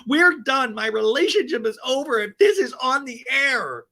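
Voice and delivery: high, loud mock ultimatum; hammed-up outrage, imitating her; mock fury. Cast member ending friendship if it airs, furious.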